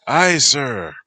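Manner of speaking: high, very loud acknowledgement; loud aye. Acted. First mate answers the captain, loud and crisp. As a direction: bark back the reply.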